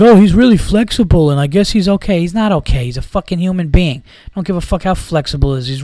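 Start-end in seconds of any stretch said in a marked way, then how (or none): 0.0-2.2 high, loud mocking voice of the media; mocking quote. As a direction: sneer at the reporters' line in a higher, louder voice. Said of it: "It's like".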